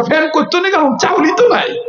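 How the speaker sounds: high, rising mock old woman's reply; imitating grandmother. Preacher mimics his grandmother's retort, high and rising. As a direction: pitch it way up like a fussy old woman. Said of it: "কয়".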